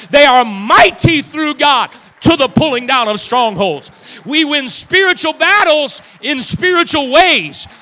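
loud, high, ringing pulpit declaration; full-force preaching. Real, not acted. Preacher rallies church for spiritual battle, full-force preaching. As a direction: preach it hard, drawing out the key phrases.

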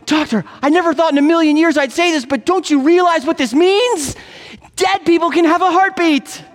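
very high-pitched, hammed-up astonishment; amazed revelation. Patient announces his mistaken discovery to doctor, amazed.

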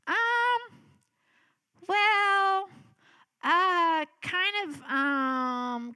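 high, stretched-out hesitations; sheepish stalling. Retold or imitated. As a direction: draw out each filler, dreading the news. Retold stalling before breaking news.

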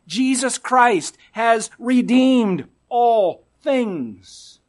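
"redeemed" stretched, slow and strong; slow declaration. Real, not acted. Preacher proclaiming the gospel to the church, slow and emphatic. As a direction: proclaim it slowly, weight on "redeemed".

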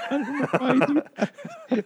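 high laugh through the words. Real, not acted. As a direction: giggle while saying it. Laughing at own odd habit.

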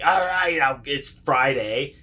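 a touch of fed-up dad; mildly pissed-off dad. Retold or imitated. Dad sent out at night, mock exasperation. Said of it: "he's probably already pissed off".

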